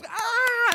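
long, high, loud "aah"; imitated crowd cheer. Storyteller imitating the gorilla's growing audience, high drawn-out cheer. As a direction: draw out a high crowd roar.

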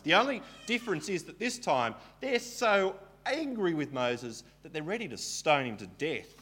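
ordinary delivery

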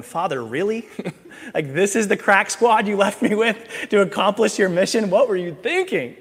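lightly voiced, a hint of exasperation toward heaven; exasperated Jesus. Jesus complaining to his Father about his disciples, mock exasperated. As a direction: sigh up at the Father in mock despair.

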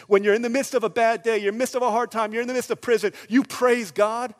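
loud, high, driving list; full-force preaching. Real, not acted. Preacher urging praise in hard times, full-force preaching. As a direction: build hard to the final phrase.

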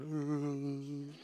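long, soft droning "arr"; quiet, playful drawn-out vocal drone; mimicked noise. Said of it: "and Zach's going".

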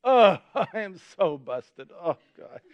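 laughing, I am so busted; mock panic, half laughing. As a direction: caught out with a laugh. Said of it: "and I'm like".

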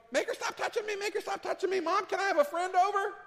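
put-on high whiny kid complaining; whiny tattling little sibling. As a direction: tattle to mom like a bratty kid. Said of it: "you just get into this whole".

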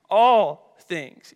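"all" hit loud and held; stress on ALL. Real, not acted. Preacher correcting "some things", loud stress on ALL. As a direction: punch "all" loudly, then let "things" fall. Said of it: "You can circle that word all".

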